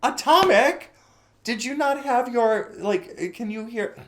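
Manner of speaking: high, loud call across the room. Real, not acted. Streamer loudly calling a chatter who missed the greeting. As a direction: yell the name to get their attention.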